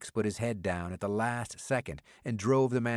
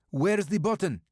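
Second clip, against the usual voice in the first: put-on loud, high Russian-accented demand; 10 semitones higher, 6 dB louder than usual. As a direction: interrogate in a heavy Russian accent. Russian attacker interrogating Maddox.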